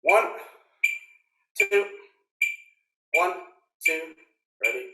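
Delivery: crisp count-in, each number spaced. Real, not acted. Drum teacher counting the class in to start, crisp count-off. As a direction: call the count-in sharply to the band.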